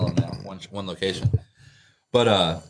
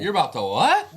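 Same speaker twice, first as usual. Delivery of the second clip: a hint of disbelief, pitched up; incredulous; 6 semitones higher, 3 dB louder than usual. Coworkers challenge the intruder, mildly incredulous. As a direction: challenge him: you're about to what?. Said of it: "We're like".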